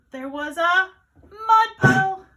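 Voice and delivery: slow, loud, drawn-out reveal; big storytime announcement; dramatic story reveal. A narrator's reading. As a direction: build suspense then call out the puddle, high and loud. Dramatic reveal in picture book.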